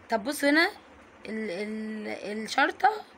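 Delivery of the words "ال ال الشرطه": ordinary talk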